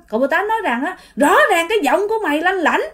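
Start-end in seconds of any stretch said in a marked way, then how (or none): 1.2-2.8 higher-pitched accusing insistence; acted heated scolding; disbelieving uncle. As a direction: press the accusation, refusing to believe. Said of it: "cậu Mơ Tám không tin".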